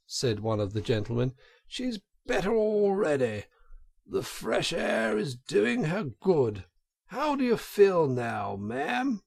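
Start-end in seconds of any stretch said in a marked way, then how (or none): 1.7-9.2 higher, concerned gentleman's voice; a sympathetic motorist fussing gently; kindly gentleman. A narrator's reading. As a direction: gentleman pitying the fainted washerwoman, higher and kind.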